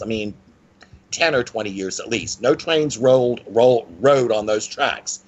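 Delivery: usual speaking voice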